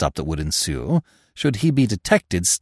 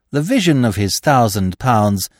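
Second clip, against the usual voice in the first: plain reading voice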